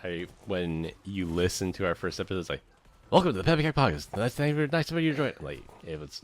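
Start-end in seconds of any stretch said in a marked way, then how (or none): 3.1-5.3 higher, rushed podcast intro; way too fast. Retold or imitated. Host imitating their rushed first-episode intro, far too fast. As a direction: race through it like a nervous rookie host. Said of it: "it was way too fast".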